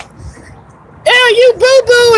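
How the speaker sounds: very high, drawn-out mock disgust; hammed-up high girl voice, slow and loud; imitated disgusted girl. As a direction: squeal it like a grossed-out girl. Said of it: "She's like".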